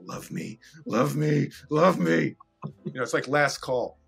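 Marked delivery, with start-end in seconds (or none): 0.0-2.3 from a whisper up to a louder plea; silently beg, then let it swell; whispered love me growing into a loud plea; building plea. Retold or imitated. Silent inner plea to Phil.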